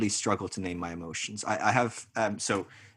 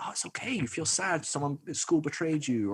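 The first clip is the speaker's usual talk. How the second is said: soothing parent; 5 semitones higher than usual. Acted. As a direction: gently comfort an upset child. Imagined parent comforting upset child.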